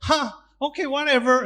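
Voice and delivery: high, airy brush-off; dismissive shrug. Retold or imitated. Brushing off a stranger who calls you poor, dismissive. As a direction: shrug it off like it doesn't matter. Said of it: "You say".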